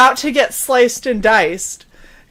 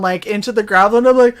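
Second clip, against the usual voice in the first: ordinary talk, nothing marked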